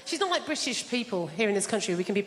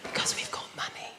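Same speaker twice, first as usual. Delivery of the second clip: whisper; 6 dB quieter than usual. Acted. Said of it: "comic hush".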